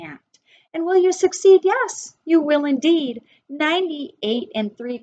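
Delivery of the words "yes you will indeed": ordinary delivery, nothing marked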